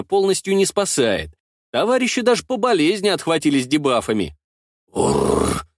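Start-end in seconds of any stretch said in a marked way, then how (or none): 4.9-5.7 a deep rumbling purr; low growl. A narrator's reading. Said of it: "урчит за спиной Ктулху".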